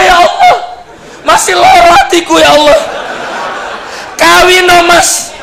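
high, loud mock lament of a wife; wife's lament. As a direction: play the hurt wife crying to God. Wife cries over husband remarrying.

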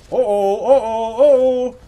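loud, high "oh-oh" cries; string of alarmed hollers; panicked wails. Real, not acted. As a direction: wail wordlessly under attack. Under attack in game.

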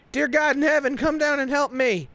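high-pitched pleading call; desperate cry to heaven. Retold or imitated. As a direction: call out like a frantic zealot. Richard's cry comes round again.

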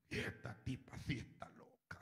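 soft unvoiced whisper; whispered aside. Thief spotting the cameras, whispered aside. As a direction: whisper it in alarm to yourself.

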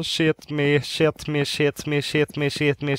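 ordinary delivery

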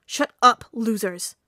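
loud, angry outburst; yelling. A narrator's reading. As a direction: yell down the bickering classmates. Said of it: "Bakugo yelled".

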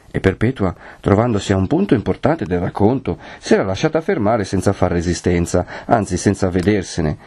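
ordinary delivery, nothing marked

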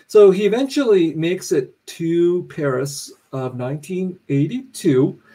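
plain reading voice

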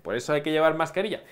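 ordinary talk, nothing marked

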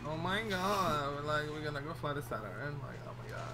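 high, drawling Valley Girl impression; exaggerated Valley Girl accent, vowels stretched. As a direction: gush like a mock Valley Girl. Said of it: "that's a very Valley Girl".

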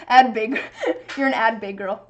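amused, breaking into laughter; real laughing. Real, not acted. Streamer trying Pig Latin on a viewer, laughing. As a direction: laugh between the words.

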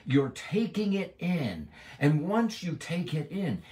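high, loud pulpit preaching; full-force preaching. Acted. Preacher urging listeners to eat the bread of life, at full force. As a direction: preach it out to the congregation.

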